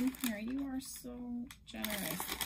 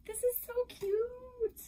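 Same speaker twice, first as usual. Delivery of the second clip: much higher, squealed; squealing over cute; 14 semitones higher, 3 dB louder than usual. Real, not acted. Cooing at a preemie outfit, squealed high.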